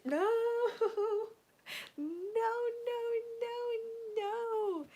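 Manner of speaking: high, tearful drawn-out wail. Real, not acted. Fan reacting to a painful reminder, wailing. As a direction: cry out in high-pitched protest.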